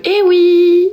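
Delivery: high, loud playful eh oui; exclaiming. Real, not acted. Telling viewers it's a game, loud playful exclaim. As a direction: toss out a loud playful yes.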